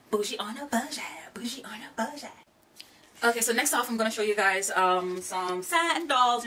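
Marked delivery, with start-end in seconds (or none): 0.1-2.3 whispered catchphrase. Real, not acted. Vlogger whispering her catchphrase to her girls, hushed. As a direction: whisper bougie on a budget, twice over.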